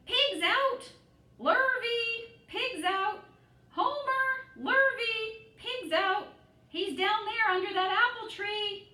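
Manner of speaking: high, loud alarm call across the farm; crying out. A narrator's reading. Farm wife shouting for the men from the window, high and loud. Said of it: "she cried".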